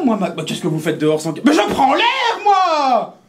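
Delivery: very high, loud outraged shriek; high shrill yelling lady. Acted. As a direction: scream it in high indignation. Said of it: "ça commence à gueuler".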